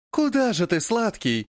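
high, tender and hurt; нежно и обиженно. A narrator's reading. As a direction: coax him back, sweetly sulking. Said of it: "Голосок за спиной полон нежности и обиды". (нежным обиженным голоском)

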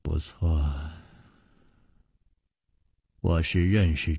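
Madam Ren answers slowly, low and soft; a low, soft, unhurried widow's reply. A narrator's reading. Madam Ren slowly answers Chu Liuxiang, low and soft. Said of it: "他终于缓缓地说".

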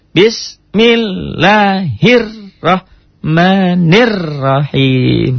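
very slow, chanted "bismillahirrahmanirrahim"; slow recitation. Shown as a demonstration. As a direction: sing it out smoothly with the stop. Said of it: "Sudah hentakannya bagus".

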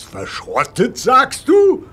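shocked disbelief. Acted. The boss hears the engine should be scrapped, shocked shout. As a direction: shout it out like he can't believe his ears.

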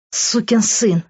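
contained, high-voiced fury; angry hiss. A narrator's reading. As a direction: spit it out at him, without shouting. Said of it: "прошипела она".

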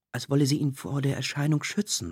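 ordinary delivery, nothing marked

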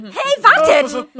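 loud, slow call after them. Acted. Hermine yells at Harry and Ron to wait. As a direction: shout to make them stop.

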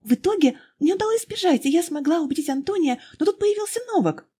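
put-on high, eager young woman pouring out her story; young girl's voice. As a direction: pitch it up high, breathless and earnest. Girl continues her tale. (высокий взволнованный девичий голос)

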